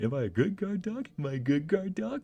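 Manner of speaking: high, eager puppy voice asking twice; dog voice. Retold or imitated. Dog asks owner for praise, in high eager puppy voice. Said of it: "He's like".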